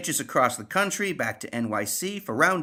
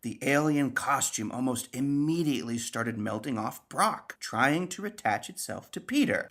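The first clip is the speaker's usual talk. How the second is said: ordinary talk, nothing marked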